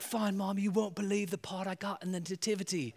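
put-on a small boy bursting with news; British kid voice. Milo telling his mom his part, proud kid voice. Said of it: "he's like".

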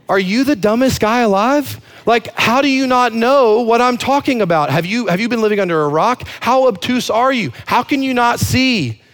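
high-pitched, incredulous mock condescension; pretentious. Disciples scolding a clueless stranger, high pretentious disbelief. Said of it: "It's so pretentious".

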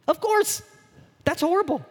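very high, put-on exclamations; mock complaint. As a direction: squeal it in mock dismay. Playing up the shallow pool complaint.

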